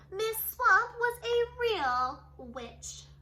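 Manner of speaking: slow, loud ominous line; dramatic emphasis. A narrator's reading. Narrator warning children about the teacher, slow and loud. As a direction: lean into the scary word witch. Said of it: "dramatic reading".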